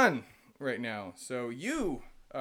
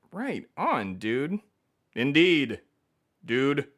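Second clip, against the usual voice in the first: ordinary delivery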